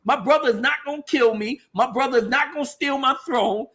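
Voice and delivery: the king defends his brother to his advisors, heated and high; impassioned brother. Retold or imitated. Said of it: "he's like".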